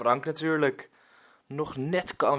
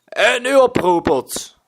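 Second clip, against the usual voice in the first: loud, much higher bellow; roaring pirate; 11 semitones higher, 12 dB louder than usual. Acted. Pirate Willem chases the boy away, loud roar. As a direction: roar him off your doorstep. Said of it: "brult Willem".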